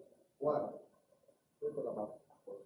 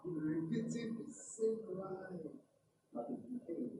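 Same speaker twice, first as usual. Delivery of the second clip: slow teacher definition; 5 semitones higher, slower than usual. Shown as a demonstration. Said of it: "teacher defining a term slowly".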